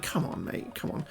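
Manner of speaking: half-whispered, under the breath; hushed exasperated plea. Retold or imitated. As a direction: mutter a quiet complaint at a stranger. Muttered aside at badly parked driver.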